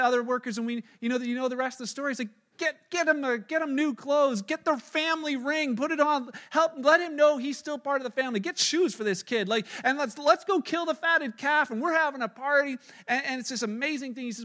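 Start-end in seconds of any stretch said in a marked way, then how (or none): 2.6-12.8 high, loud, urgent celebration; excited orders. Acted. Overjoyed father telling workers to ready a party, excited. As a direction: rattle off joyful commands to the household.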